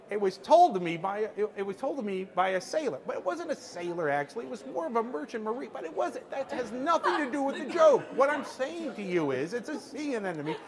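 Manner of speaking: mock exasperated nitpicking over a joke; rambling Albert Brooks. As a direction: imitate a comic digressing on and on. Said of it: "he is on and on and on".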